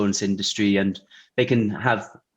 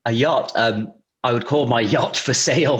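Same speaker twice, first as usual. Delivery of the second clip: usual speaking voice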